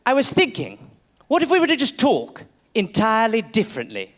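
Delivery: hesitant mock British accent; old British gentleman. Colonist suggesting a new way to talk, mock British accent. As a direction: float an idea in a stuffy English voice. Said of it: "He's like".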